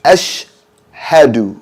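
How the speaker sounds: Ashhadu with the sh and h split, slow; separated sh h. Shown as a demonstration. Teacher models the correct Ashhadu slowly for students. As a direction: pull sh and h apart in Ashhadu. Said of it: "We should say ashhadu".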